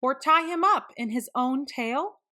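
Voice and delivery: usual speaking voice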